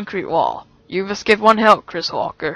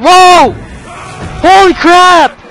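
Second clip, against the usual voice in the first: frightened shouting; 5 semitones higher than usual. Real, not acted. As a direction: still rattled, yelling.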